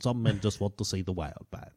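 low, quiet, half-hearted Michael Caine impression; bad Michael Caine. Retrying the Alfred line for a friend, a weak Michael Caine impression. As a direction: mumble it like a failed Michael Caine. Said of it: "I can't do my, my, Michael Caine".

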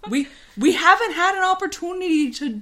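high, lilting put-on Minnesota accent; broad playful Minnesota vowels. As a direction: plead your case as a hammy Minnesotan. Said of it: "to do that accent".

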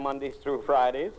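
usual speaking voice

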